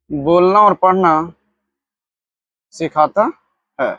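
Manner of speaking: each word set apart with long pauses; slow dictation. Shown as a demonstration. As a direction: dictate slowly so students can write it down. Teacher dictates definition word by word.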